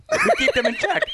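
high laughing shout; laughing, shouted boast. Real, not acted. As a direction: yell it out through a laugh.

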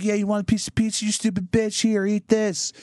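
high, mocking taunt; taunting pizza king. Pizza king taunting a fan from the convertible, high mocking voice. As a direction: sneer while handing out pizza.

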